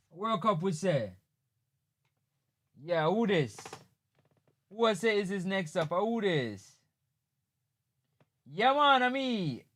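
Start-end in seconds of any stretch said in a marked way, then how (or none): none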